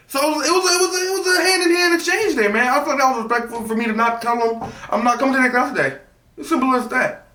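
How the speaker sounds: high, fast joking voice; bragging playfully about telling teachers; mock respectful. Real, not acted.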